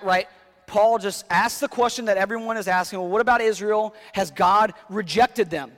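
preaching at full force. Acted. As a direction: build the question till it peaks. Reading and voicing Paul loudly.

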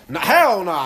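ordinary delivery

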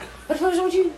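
high, rapid mimicked scolding; mock scolding parent.

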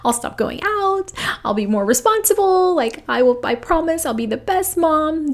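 high, pleading promise; pleading prayer. Retold or imitated. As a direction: beg like a desperate young woman praying. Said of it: "praying and praying and saying".

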